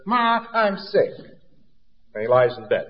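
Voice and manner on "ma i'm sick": higher, slow and a bit soft, pleading; whiny sick kid. Retold or imitated. A boy telling his mother he's sick, whiny. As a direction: drag it out like a kid faking illness. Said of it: "So he says".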